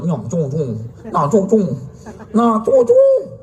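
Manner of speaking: dying man's mock Chinese, climbing to a squeak; mock dying Chinese words. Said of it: "ત્રણ વાર બોલ્યો".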